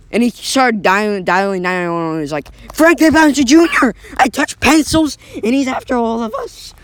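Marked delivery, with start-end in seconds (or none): 2.7-6.7 high, loud frantic plea; panicked caller. Acted. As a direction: blurt it out to the dispatcher in panic. Panicked 911 call.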